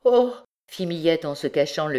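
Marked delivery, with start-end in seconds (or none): 0.0-0.3 short, high "Oh" of horror; a brief first cry of dismay; horrified gasp. A narrator's reading. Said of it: "plus d'horreur que dans la première". (petit cri d'horreur)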